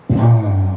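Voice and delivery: low puff of sleepy air; imitated sleeping exhale. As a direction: breathe out like central air. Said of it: "let out a hot pocket of air".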